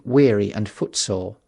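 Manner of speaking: plain reading voice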